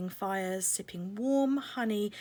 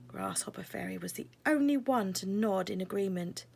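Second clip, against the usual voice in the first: ordinary delivery, nothing marked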